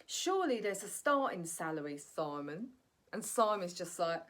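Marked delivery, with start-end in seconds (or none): none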